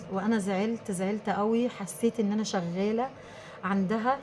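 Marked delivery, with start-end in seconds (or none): none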